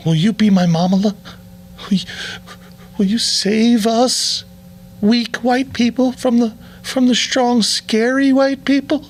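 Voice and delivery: high, slow, whiny pleading voice; mock pleading. Kneeling actress begging her savior in a high plea. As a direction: beg like a helpless damsel. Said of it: "looks up at her savior and goes".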